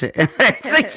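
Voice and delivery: ordinary talk, nothing marked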